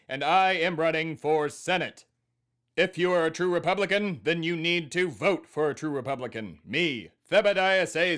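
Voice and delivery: loud, high-pitched stump oratory; campaign speech. Acted. Host announces Senate run to listeners, campaign speech. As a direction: proclaim your candidacy to the whole nation.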